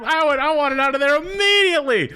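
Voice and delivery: high, loud venting; worked-up exclaiming; emphatic rant. Real, not acted. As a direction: let it out at the top of your range. Ranting about wanting to leave.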